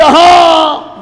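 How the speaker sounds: mock agreeing listener. Preacher mimics listener giving in, hammed-up हाँ. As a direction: play the swayed listener. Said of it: "आप भी कहने लगे".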